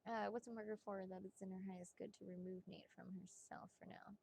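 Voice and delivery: hushed private question; whisper. Real, not acted. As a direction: whisper it to the cards.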